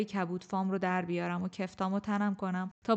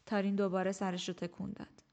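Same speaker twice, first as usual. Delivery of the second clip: plain reading voice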